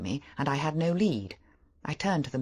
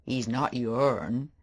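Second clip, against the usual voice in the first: slow simple fisherman; much slower than usual. A narrator's reading. The idiot fisherman greeting the stranger, slow and simple. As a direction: drag the words out like a simple old digger.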